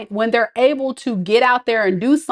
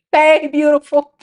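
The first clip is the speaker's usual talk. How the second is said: higher cheesy suitor line; mock suitor; 5 semitones higher than usual. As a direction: ham up a flirty stranger. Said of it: "folks talking about".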